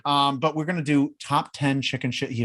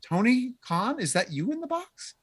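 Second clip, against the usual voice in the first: high mock call; 8 semitones higher, 4 dB quieter than usual. Teasing call to the boxed man.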